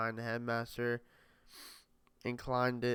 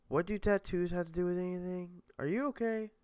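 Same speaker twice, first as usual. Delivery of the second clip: higher female voice; 7 semitones higher than usual. Acted. Maze asks, puzzled.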